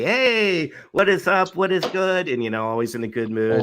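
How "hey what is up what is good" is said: cheerful host hello; upbeat mock welcome; happy greeting. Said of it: "he's very happy".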